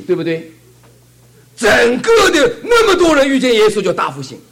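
high, loud preacher's cry, breaking near the end; full-force preaching. Acted. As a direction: shout the revival to the congregation.

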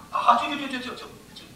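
quick chant fading to near nothing; rapid repetition fading. Acted. Preacher miming Abraham's bargaining, quick and fading. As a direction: rattle it off and let it die away.

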